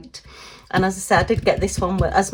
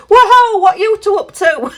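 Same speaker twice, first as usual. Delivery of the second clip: high, loud teasing call; nosy friend barging in; 11 semitones higher, 8 dB louder than usual. Pretend. Friend interrupting the new couple, high loud teasing. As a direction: burst in on the couple, mock-loud and cheeky. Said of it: "your friend bursts in and says".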